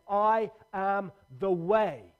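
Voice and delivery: slow, loud, each word weighed; a stranger slowly proclaiming in a shop; slow proclamation. Retold or imitated. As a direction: declare it slowly like a stranger calling out. Someone stood up and said.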